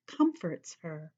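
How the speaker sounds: ordinary delivery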